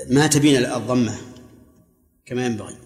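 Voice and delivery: usual speaking voice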